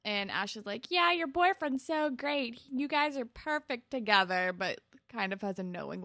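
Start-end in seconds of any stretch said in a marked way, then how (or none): 0.9-4.5 higher, mock-enthusiastic; retold gushing praise. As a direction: imitate someone gushing about a couple. Voicing Ash's overdone praise.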